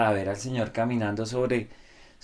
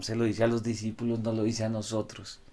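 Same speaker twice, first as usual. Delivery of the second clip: ordinary delivery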